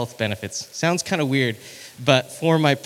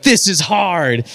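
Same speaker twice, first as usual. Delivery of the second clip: strained; 5 semitones higher, 4 dB louder, slower than usual. Retold or imitated. Mock inner voice on hill.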